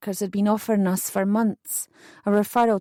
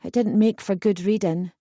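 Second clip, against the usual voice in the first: ordinary delivery, nothing marked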